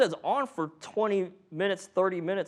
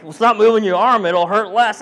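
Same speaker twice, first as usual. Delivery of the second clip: a louder, laughing, fed-up reply to the patient; exasperated medic; 6 semitones higher, 10 dB louder than usual. Retold or imitated.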